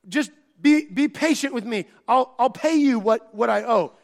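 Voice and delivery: desperate servant's plea; pleading servant. Retold or imitated. Servant begging master for patience.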